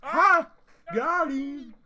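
high, loud gotcha yell; exaggerated high-pitched triumphant meme shout; These Nuts man. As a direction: imitate a prankster crowing over his punchline. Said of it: "like the These Nuts man".

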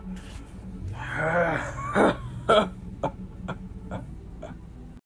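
long villain cackle; evil laugh. Acted. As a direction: laugh at the doomed enemy. Villain laughs after his boast.

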